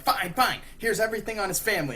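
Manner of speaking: hurried, high-pitched mock surrender; hammed-up frantic caving in; Joker. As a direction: give in loudly and fast. Panicked Joker gives in to demands.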